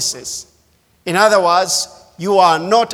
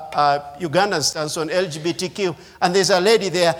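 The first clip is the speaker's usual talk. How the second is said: ordinary delivery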